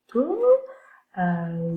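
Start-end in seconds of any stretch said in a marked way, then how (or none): 0.1-0.6 tomato with a sharp high rise on the end, much higher; French learner rising tune. Shown as a demonstration. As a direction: say tomato like a French student asking a question, pitch shooting up. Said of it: "they might not even have a rising intonation".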